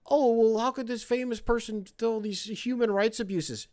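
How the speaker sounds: high, whiny scold voice; lightly mocking scold; mocking critic. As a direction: sneer it like a prissy critic. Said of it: "mock critic".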